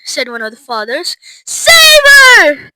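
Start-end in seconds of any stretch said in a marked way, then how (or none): 1.5-2.7 high, loud wail; desperate cry. A narrator's reading. Mother wringing her hands, crying out desperately. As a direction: plead at full voice. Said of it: "cried Missus Beauregard".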